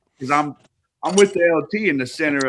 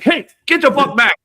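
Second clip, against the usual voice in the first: high, loud mock yell; 9 dB louder than usual. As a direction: bark them off the garage. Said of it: "and I'm like".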